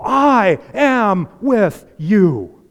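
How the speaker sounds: drawn out and loud, every word weighed; slow emphatic promise. Acted. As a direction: let each word land as God's promise. Climactic quote of God's promise.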